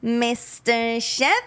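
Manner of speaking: slow, drawn-out accent imitation; long, stretched character-voice call; put-on character accent. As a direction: draw the name out in a put-on accent. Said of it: "you do your accent".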